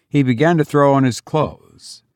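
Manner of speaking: plain reading voice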